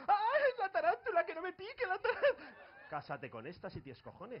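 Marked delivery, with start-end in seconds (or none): 0.1-2.5 high-pitched mock panic; hammed-up, high frightened wail; panicky jungle friar. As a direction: squeal in comic fright. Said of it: "el fraile de la jungla de".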